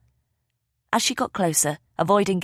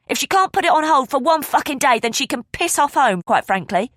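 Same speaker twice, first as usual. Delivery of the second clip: higher and louder, a furious snap; snapping angrily; 9 semitones higher, 6 dB louder than usual. A narrator's reading. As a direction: bite his head off. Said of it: "Gail snapped".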